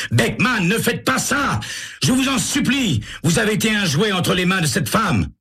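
high, frantic begging; pleading with a man about to shoot; high-pitched panicked imploring; desperate plea. Acted. Sam bursts in on a gun.

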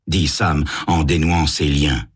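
usual speaking voice

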